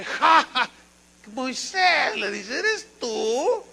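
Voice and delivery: high, scornful laughing taunt; mocking laugh. Pharaoh laughing scornfully at the humble Moses. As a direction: laugh him off as a nobody. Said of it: "se ríe el faraón".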